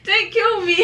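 ordinary talk

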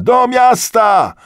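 very high, loud, drawn-out yell; escalated crowd cry. A narrator's reading. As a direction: stretch the slogan out at the top of the voice. Repeated, escalating rallying cry. (przeciągły, bardzo głośny okrzyk)

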